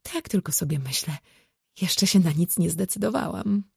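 ordinary delivery

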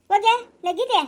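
loud, high summons; exasperated calling out. Acted. Sleepless elder calling to the younger woman, exasperated shout. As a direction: shout for her to go.

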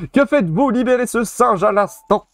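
high, demanding call; commanding character. Acted. A character demanding the monkey's release, high and imperious.

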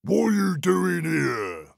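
slow, growling troll question. Acted. Troll questioning the youngest goat, slow looming voice. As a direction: loom over the little goat.

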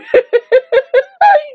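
squealing, helpless laugh; high disbelieving laugh. Real, not acted. Viewer overwhelmed by the twist, high helpless laughing. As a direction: break into a high-pitched giggle.

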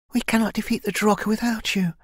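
high-pitched, earnest plea; pleading young heroine. Acted. Kai tells elder they cannot win alone, pleading. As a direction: implore in a lifted heroine's voice.

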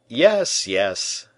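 aloud. A narrator's reading. D'Artagnan answers the bishop, aloud. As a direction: agree out loud. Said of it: "said D'Artagnan aloud".

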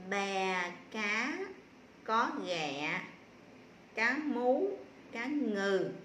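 measured reading demo with pauses; model reading. Shown as a demonstration. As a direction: read haltingly in short, separate phrases. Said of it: "cô sẽ đọc cái câu này". (đọc mẫu chậm, ngắt từng cụm)

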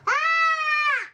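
high squeal of a cry; playful high yelp; sudden high cry. Real, not acted. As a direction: let out a sharp high cry. Child cries out in play.

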